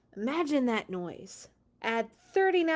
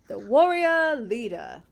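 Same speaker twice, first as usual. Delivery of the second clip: slow, louder, grand announcer voice; super fancy title; 3 semitones higher, 11 dB louder than usual. Pretend. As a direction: announce it like an epic movie trailer. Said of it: "which makes it sound super fancy".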